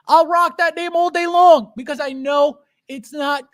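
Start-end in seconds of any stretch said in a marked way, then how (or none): 0.1-1.7 defiant shout. Real, not acted. Defiant streamer shouting at chat.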